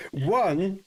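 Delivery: usual speaking voice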